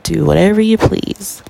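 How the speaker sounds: ordinary delivery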